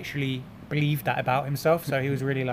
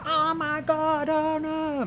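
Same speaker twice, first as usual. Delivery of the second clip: very high, drawn-out imitated wail; mock horrified squeal; 14 semitones higher, much slower than usual. Girlfriend reacting to him showing off, mock horror.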